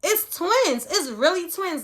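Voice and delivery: high, loud excited shout. Retold or imitated. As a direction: scream it out in joyful shock. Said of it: "kept on screaming that out".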